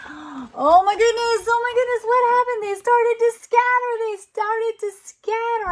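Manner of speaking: high pretend astonishment; mock astonishment. Parent playing along with picture book, astonished. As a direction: act amazed for the little one.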